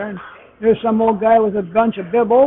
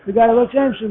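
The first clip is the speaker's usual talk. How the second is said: ordinary talk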